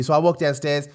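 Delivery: ordinary delivery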